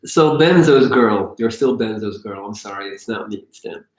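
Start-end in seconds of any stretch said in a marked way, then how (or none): none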